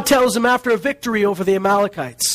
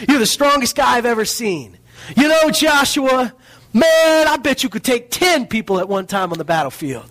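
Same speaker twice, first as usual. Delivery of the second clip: higher hyped-up flattery, climbing on man; mock hype speech; 6 semitones higher than usual. Preacher mocks Moses hyping Joshua as a warrior, higher gushing flattery. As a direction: pump him up like a gushing buddy. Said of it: "Well, I'll tell him he's a mighty warrior".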